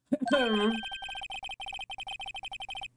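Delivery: high, hammed-up throat clearing; exaggerated throat clearing; over-the-top throat clearing. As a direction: clear the throat as theatrically as possible. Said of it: "most over-the-top clearing of the throat".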